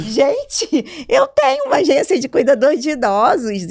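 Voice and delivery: high, loud, laughing exclamation; thrilled disbelief. Retold or imitated. Retelling her reaction to a first client, thrilled disbelief. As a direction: burst out as if amazed by your own news. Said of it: "Aí o coração palpitou".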